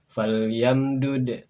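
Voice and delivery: Falyamdud drawn out very slowly; slow Arabic demo. Shown as a demonstration. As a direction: stretch Falyamdud, bounce the final d. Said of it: "Berikutnya silakan".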